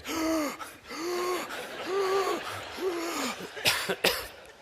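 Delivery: high, wordless drawn-out gasps, repeated; imitated despairing gasps. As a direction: mimic despondent people with exaggerated high gasps. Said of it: "walking around the corridor in the hotel like this".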